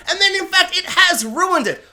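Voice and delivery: comic indignant peak; worked-up outburst. Real, not acted. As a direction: proclaim it loudly, getting worked up. Sudden worked-up realisation.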